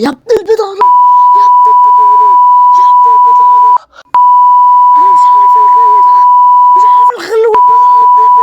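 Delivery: high, loud screaming rage at another player; furious yell. Real, not acted. Gamer screaming at an annoying player, furious yell. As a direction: scream it out in fury.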